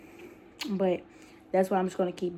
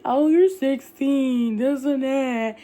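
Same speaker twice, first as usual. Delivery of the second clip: mocking gossip; 5 semitones higher, 6 dB louder, slower than usual. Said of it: "people started talking about me".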